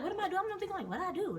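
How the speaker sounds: high-pitched flustered questioning; squeaky, confused self-mockery; mock bewildered panic. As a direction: imitate a clueless recruit. Bewildered recruit after being yelled at.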